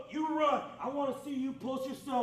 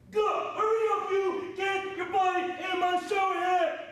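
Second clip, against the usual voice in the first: barked orders at full volume; drill sergeant shouting; 6 semitones higher than usual. Real, not acted. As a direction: yell it like a drill instructor. Drill instructor rushing recruit.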